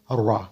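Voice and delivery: thick, emphatic را; heavy ra. Shown as a demonstration. Teacher illustrates the rule just given, thick را. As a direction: make the r in را heavy.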